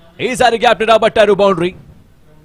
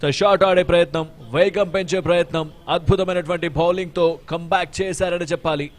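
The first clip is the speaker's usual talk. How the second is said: raised live play-by-play; excited cricket commentary; 5 semitones higher, 5 dB louder than usual. Real, not acted. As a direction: call the wicket with energy. Live wicket call, raised voice.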